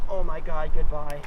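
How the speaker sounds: ordinary talk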